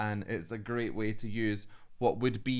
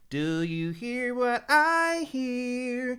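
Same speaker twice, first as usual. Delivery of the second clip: much higher, slow and louder bright sung line; high bright larynx; 13 semitones higher, 6 dB louder, much slower than usual. Shown as a demonstration. Coach imitates the bearded singer's high bright voice, singing slowly and loudly. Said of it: "Larynx is really high, very bright, very young".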